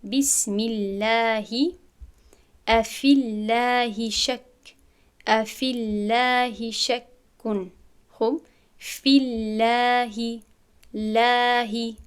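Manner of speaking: بسم الله and في الله with a thin, light lam, slow recitation; light lam in Allah. Shown as a demonstration. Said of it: "لام لفظ جلال خالی خوانده می شود".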